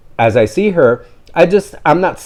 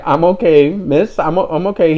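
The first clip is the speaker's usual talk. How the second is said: higher, polite it's fine; lightly imitating a polite reassurance, higher; reassuring; 7 semitones higher than usual. Reassuring the embarrassed dog owner, politely higher. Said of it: "I'm like".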